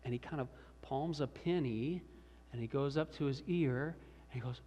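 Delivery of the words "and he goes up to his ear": ordinary delivery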